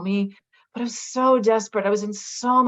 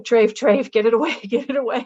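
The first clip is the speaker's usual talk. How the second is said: higher, louder, half-laughing revulsion; mock disgusted recoil; 4 semitones higher, 6 dB louder than usual. Voicing horror at tuna in her kitchen, mock disgust. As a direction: shoo the unclean food away, laughing.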